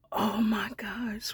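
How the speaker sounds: breathy hushed gasp of feeling; awed whisper. Real, not acted. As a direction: whisper it, moved and overwhelmed. Overwhelmed watching goodbye scene.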